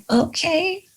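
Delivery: soft, small, rising "Okay."; meek okay. Retold or imitated. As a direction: give in, quietly stunned. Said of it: "I'm like".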